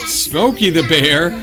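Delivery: ordinary talk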